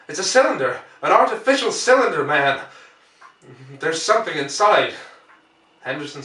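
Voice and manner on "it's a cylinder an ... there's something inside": urgent insistence. Acted. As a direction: make him believe it.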